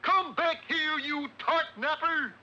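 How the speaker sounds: high-pitched cartoon chase cry; calling after thief. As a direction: holler after a fleeing thief. Calls after the fleeing thief.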